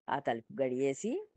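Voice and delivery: ordinary delivery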